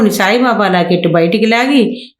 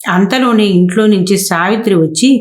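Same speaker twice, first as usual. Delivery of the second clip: ordinary delivery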